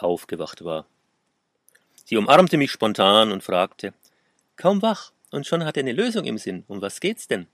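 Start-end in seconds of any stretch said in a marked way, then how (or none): 4.6-7.5 higher, lilting woman's question; light, high-pitched curious ribbing; playful teasing. A narrator's reading. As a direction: tease with a lifted, swooping pitch. Lara teasingly questions the narrator.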